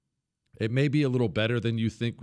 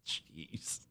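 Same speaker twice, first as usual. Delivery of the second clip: normal talking voice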